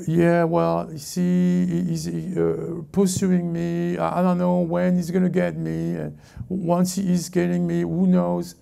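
slow, monotone mock voice of a helpless complainer; whining baby believer. Preacher mimics a believer fretting about the enemy, babyish monotone. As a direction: drag it out on one note, like a big baby. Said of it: "because he's a baby".

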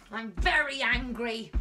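march the words out like an angry stomp; acted anger. Drama teacher playing the angry mask for kids. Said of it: "angry mask".

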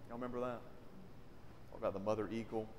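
ordinary talk

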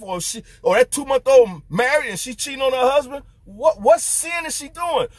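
mock the idea with rising disbelief; incredulous preaching. Incredulous rhetorical preaching run.

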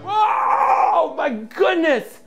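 very high falsetto shriek of disbelief; mock astonishment. Entertainer reacts to children's sneeze through the internet, hammed-up shock. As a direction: squeal as if blown away, stretching the words out.

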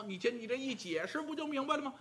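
usual speaking voice

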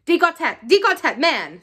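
high, loud hail, easing on the last word; calling out. A narrator's reading. Rupert summoning his companion from the drawbridge, calling out. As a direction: call him from across a drawbridge. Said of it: "sounded from the br-, drawbridge".

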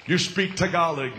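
usual speaking voice

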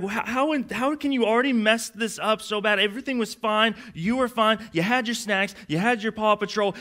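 high-pitched, incredulous parental rant; exasperated parent. Acted. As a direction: pile up the complaints in mock bewilderment. Acts parent scolding the child.

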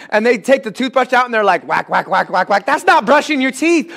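ordinary delivery

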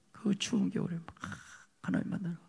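breathy hushed telling; whisper. Real, not acted. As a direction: draw them in with a secret memory. Recalling lonely winter prayer. (추운 겨울 기도를 떠올리며 속삭이듯)

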